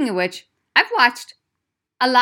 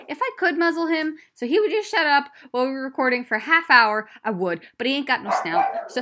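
ordinary delivery

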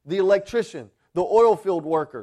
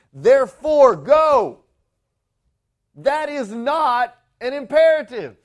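"Therefore, go" called out much louder; preaching shout; 5 semitones higher, 8 dB louder than usual. Acted. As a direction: boom it out from the pulpit. Preacher quoting the great commission.

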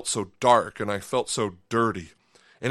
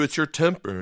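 ordinary talk

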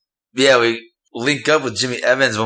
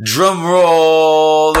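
high, loud, stretched-out build-up; hammed-up game show suspense; drum-roll announcement; 6 semitones higher, 5 dB louder than usual. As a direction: hold the last word like a drum roll.

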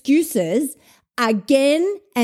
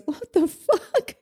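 quoting Phil; 8 semitones higher, slower than usual. Retold or imitated. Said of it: "and he goes".

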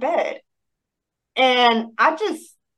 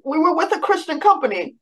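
ordinary talk, nothing marked

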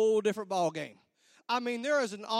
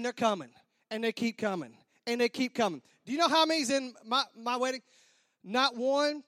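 normal talking voice

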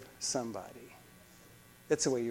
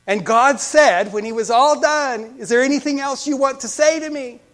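loud, high pulpit delivery; raised preaching; 10 semitones higher, 13 dB louder than usual. Acted. Preacher retelling God's question to a grieving bishop, forcefully. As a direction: build up to God's question and ring it out.

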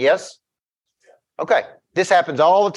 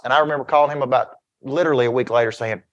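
ordinary delivery

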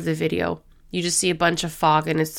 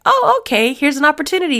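much higher, louder, breezy mock God; playful God voice; 9 semitones higher, 5 dB louder, faster than usual. God cheerfully offering an unplanned chance, high and breezy. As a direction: toss it off brightly, as if it's obvious. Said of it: "And God's like".

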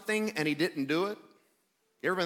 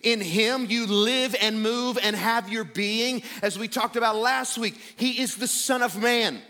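raised, emphatic sermon proclamation; fervent pulpit declaration; impassioned preaching; 3 semitones higher, 3 dB louder, slower than usual. Real, not acted. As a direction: build in pitch as you declare his majesty. Preaches Christ's exalted status.

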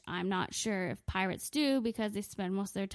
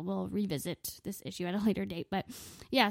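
ordinary talk, nothing marked

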